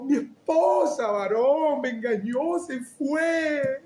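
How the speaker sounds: high, drawn-out tearful lament, slow; hammed-up sorrow; mock weeping. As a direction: play the heartbroken husband. Friend retells betrayal.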